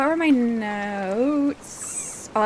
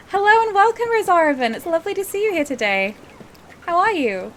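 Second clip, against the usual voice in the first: much higher, bright sing-song welcome; high cheery greeting; 9 semitones higher, 5 dB louder than usual. Pretend. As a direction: greet them in a high happy voice. Greeting a new chat member.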